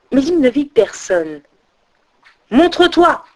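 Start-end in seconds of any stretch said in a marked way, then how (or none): 2.5-3.2 high, challenging call; crying out. A narrator's reading. Odin to an unseen voice in the darkness, crying out. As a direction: call into the dark, pitch raised. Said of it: "s'écria-t-il dans l'obscurité".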